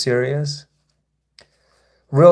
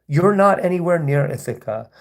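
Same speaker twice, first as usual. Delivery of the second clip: ordinary talk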